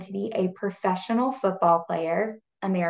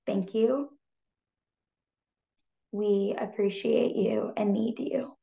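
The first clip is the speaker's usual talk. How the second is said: choked-up whisper of thanks; real emotion, barely voiced; emotional whisper; 4 dB quieter than usual. Real, not acted. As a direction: whisper it, fighting back tears. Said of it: "that's making me emotional".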